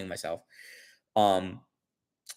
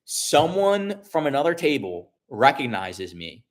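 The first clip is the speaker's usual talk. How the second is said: ordinary talk, nothing marked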